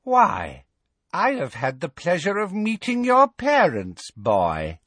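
high, gleeful witch voice; evil smile. A narrator's reading. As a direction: relish it, cruelly sweet. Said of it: "a truly evil smile".

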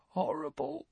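soft high croak. A narrator's reading. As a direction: croak it weakly. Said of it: "croaked Dudley".

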